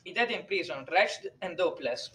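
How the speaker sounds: put-on grave, hushed Italian-accented priest. A narrator's reading. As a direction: break the news softly in an Italian accent. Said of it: "in a pronounced Italian accent".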